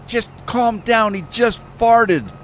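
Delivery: high, slow, drawn-out deadpan; mock calm friend. A friend calming the driver about the fart, slow and high. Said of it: "And the guy says".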